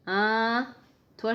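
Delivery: ordinary delivery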